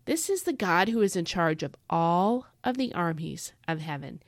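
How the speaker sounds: usual speaking voice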